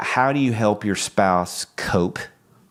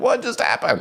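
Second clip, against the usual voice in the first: baffled question. Retold or imitated. Baffled at sudden crying.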